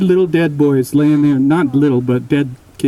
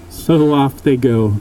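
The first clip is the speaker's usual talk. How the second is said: usual speaking voice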